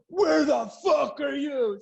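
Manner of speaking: put-on jealous boyfriend yelling at girlfriend down the phone; angry boyfriend. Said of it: "man's question repeated".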